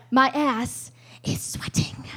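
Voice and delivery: slow whisper; slow whispered nervous aside. Acted. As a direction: confess it under your breath.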